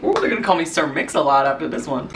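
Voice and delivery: ordinary delivery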